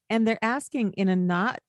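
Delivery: ordinary talk, nothing marked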